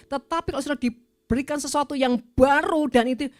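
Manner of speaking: preaching at full force. Acted. Preacher urging the congregation to embrace new callings, full-force preaching. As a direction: drive it home to the congregation, building.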